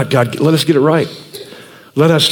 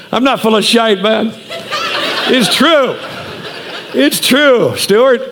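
laughing reply; 3 semitones higher, slower than usual. Retold or imitated. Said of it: "I said".

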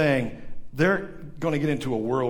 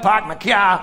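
put-on louder, insistent Boston accent; 4 semitones higher, 5 dB louder, slower than usual. Bostonian repeating himself to a baffled listener, Boston accent. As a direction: repeat it in broad R-less Bostonian.

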